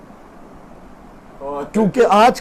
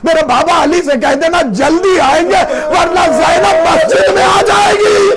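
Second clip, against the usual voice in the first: desperate plea; 7 semitones higher than usual. Acted. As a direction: beg with rising panic. Zainab's desperate plea to Abbas.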